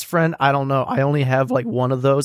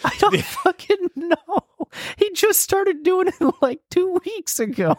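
laughing. Real, not acted. Laughing exasperated about cat.

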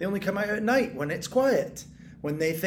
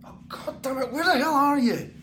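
frustrated outburst; 6 semitones higher than usual. Acted. Frustration at hidden rats.

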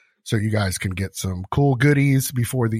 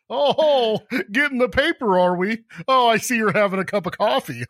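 higher, laughing fake-friendly chatter; fake chirpy small talk; 9 semitones higher than usual. Host making forced cheerful small talk with neighbor, higher and laughing. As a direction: chirp at him like everything's fine.

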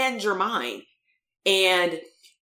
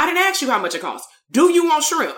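exasperated insistence; 7 semitones higher, 5 dB louder than usual. Retold or imitated. Therapist insisting to the kid.

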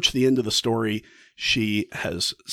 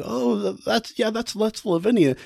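druggist recognizing her; 10 semitones higher than usual. Retold or imitated. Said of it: "And he says".

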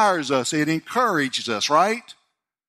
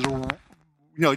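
ordinary talk, nothing marked

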